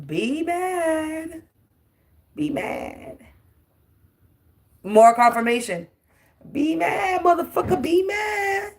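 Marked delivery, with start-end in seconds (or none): 0.1-3.2 taunting whisper. Real, not acted. 6.5-8.7 sing-song taunt. Real, not acted.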